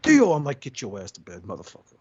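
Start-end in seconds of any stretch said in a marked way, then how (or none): 0.0-0.3 high, loud mock fright; imitated startled cry. Boy whose headset just got yanked off, mock startled yelp. 0.6-2.0 low, hushed mock menace; retold stern order. Uncle ordering the boy to bed, low hushed mock sternness.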